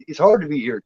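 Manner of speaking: usual speaking voice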